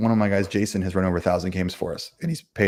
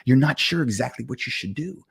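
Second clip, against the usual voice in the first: game master narration; 3 semitones higher than usual. Acted. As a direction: paint the inn for the table, unhurried. GM describes the player's state.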